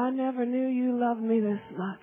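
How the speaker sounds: low, soft and full of feeling; moved, tender. Real, not acted. Believer to God on his love, moved and tender.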